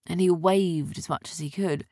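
ordinary delivery, nothing marked

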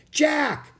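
loud high call. Acted. Annie calling Jack to stop, loud high call. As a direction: call his name across the clearing. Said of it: "Annie called".

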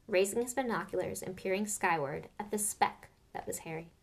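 usual speaking voice

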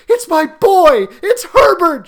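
high, loud cry of a desperate mother; wild, high-pitched insistence; frantic cry. A narrator's reading. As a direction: cry out while struggling to get free. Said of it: "she cried, struggling mechanically".

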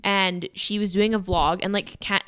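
ordinary talk, nothing marked

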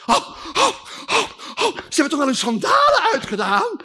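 high, loud panicky cry; alarmed Martha. Acted. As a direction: panic about the sandals, voice shooting up.

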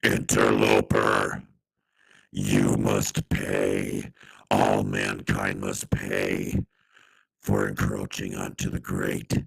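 very deep, slow monster voice; menacing. Acted. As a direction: loom over him, low and slow. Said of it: "in a loud, menacing voice".

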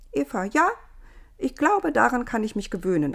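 high, pleased trying out the name; Eva trying her name. A narrator's reading. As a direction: savour the new name. Wiederholte ein paar Mal.

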